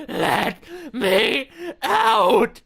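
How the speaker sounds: a trapped soul's final crying scream to be let out; sobbing scream. Acted. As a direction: scream it through tears.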